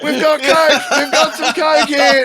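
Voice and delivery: very high, loud mock alarm; hammed-up, high-pitched excited shout; triumphant customs lady. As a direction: call everyone over to the big find. Acted officer triumphantly calling colleagues over.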